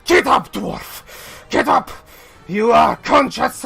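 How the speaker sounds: high, loud, frantic order; angry shout. Acted. Enraged fighter orders the fallen dwarf up, shouting. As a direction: yell at him to rouse him.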